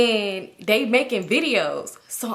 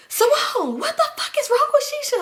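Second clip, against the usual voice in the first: very high, louder squealing question with a laugh; alarmed Toya; 4 dB louder than usual. Retold or imitated. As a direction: shriek it as a shocked friend.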